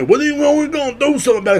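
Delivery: mock their empty promise, high and quick; mock country boy. Mocking local country folks promising to act. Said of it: "tell me".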